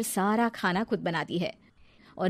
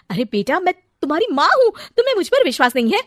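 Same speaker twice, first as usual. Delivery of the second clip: pleading; 9 semitones higher, 8 dB louder, faster than usual. Acted. Mother pleads with son.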